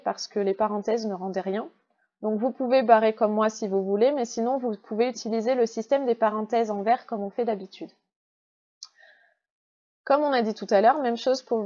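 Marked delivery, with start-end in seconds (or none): none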